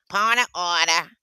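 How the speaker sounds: forceful mimicry at normal pitch; mock official's objection; Liddell. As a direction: imitate a council member calling it out. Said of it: "That's Liddell".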